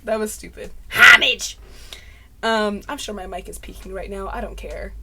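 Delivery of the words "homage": very loud theatrical outburst; hammed-up shout. Vlogger aping the Hamilton line, hammed-up shout. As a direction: blare "Homage" as HAM-ij.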